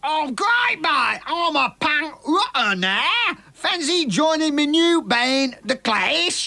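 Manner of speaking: high, slow hammed-up cockney. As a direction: lay the London geezer on thick. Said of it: "me old mucker".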